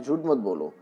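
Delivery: "झूठ मत बोलो" run together quickly, ठ softened in the flow; quick fluent speech. Shown as a demonstration. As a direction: rattle off "झूठ मत बोलो" as in fast everyday talk. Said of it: "जब हम स्पीड में बोलते हैं".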